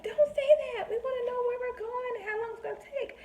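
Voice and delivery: very high mock child voice; protesting kids. Nanny kids complaining to their sitter, high-pitched mock protest. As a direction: imitate kids objecting in a high squeal. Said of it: "And they hate that".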